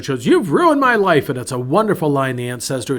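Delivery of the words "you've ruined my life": high, loud, slow wail of outrage; teen yelling. Retold or imitated. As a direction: yell it like a furious teenage girl. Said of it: "the girl yells at her".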